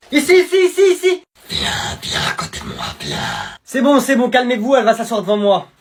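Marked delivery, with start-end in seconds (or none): 0.1-1.2 calling out. Acted. Kids calling new girl over. 1.5-3.2 whisper. Acted. Whispering to new girl.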